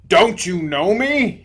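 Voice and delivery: a loud, slow call of recognition; shouted. A narrator's reading. Bright spirit to the ghost, a loud shout. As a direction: call out to him loudly and slowly. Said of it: "he shouted to the ghost".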